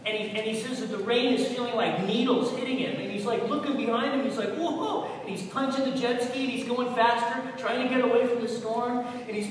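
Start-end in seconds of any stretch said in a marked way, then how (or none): 4.5-5.1 high-pitched startled "whoa"; retold yelp of alarm; startled whoop. Cousin retelling the storm behind his jet ski, startled whoop.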